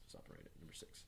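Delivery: unvoiced whisper, very soft; whispered aside. Real, not acted. Teacher quietly telling the class where to stop, whispered. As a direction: whisper the instruction. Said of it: "teacher aside to listeners".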